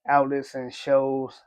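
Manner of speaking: ordinary talk, nothing marked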